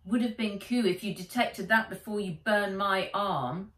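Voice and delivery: ordinary delivery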